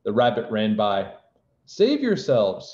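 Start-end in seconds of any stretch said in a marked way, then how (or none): 1.6-2.7 high, drawn-out alarm call; crying out. A narrator's reading. Rabbit running by warns the others, crying out. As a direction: cry it out slowly and high. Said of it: "he cried".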